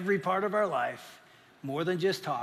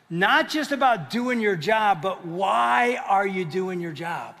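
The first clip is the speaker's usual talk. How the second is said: slow, louder preaching; preaching shout; 5 dB louder, slower than usual. Real, not acted. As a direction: drive the question home with force. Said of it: "preacher builds to climax".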